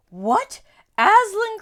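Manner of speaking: high, loud shocked outcry; shocked cry. Acted. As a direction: jolt up in alarm. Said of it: "cried the queen".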